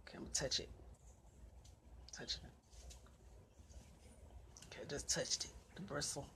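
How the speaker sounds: very soft, near-whispered self-talk; hushed nerves. Real, not acted. Woman talking herself into touching the bird, hushed. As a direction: barely voice it, as if not to wake it. Nervously inching broom toward dead bird.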